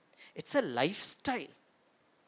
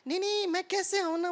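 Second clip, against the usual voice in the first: high mock whine; hammed-up car-man refusing, higher voice; high whiny refusal; 10 semitones higher than usual. Man who thinks he is a car.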